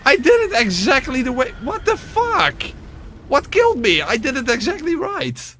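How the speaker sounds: high, loud, outraged yelling; furious disbelief. Real, not acted. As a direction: yell at the screen in disbelief. Game death, raging at screen.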